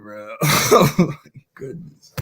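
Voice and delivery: ordinary delivery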